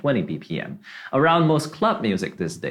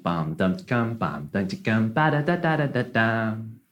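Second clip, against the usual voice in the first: spoken "bum, dum" beat with weight on the downbeats; vocalized drum rhythm; 3 semitones lower than usual. Shown as a demonstration. Drummer shows dancers' downbeat, vocalized rhythm. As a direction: sound out the dance rhythm with your voice. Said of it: "going down on the downbeat".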